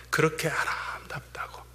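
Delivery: 숨죽여 속삭이듯; whisper. Real, not acted. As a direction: breathe it out softly in awe. Preacher drops to a whisper. (속삭이듯 조용히)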